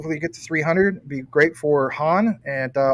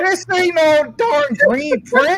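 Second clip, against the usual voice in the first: much higher, slow and loud, hammed-up protest; mock outrage; 13 semitones higher, 4 dB louder, slower than usual. Host denying a green screen to laughing guest, mock protest. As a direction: drag it out in fake indignation.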